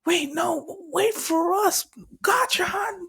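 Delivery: high-pitched pleading shout, imitated; acted-out panicky call; calling after him. Group calling after Bakugo.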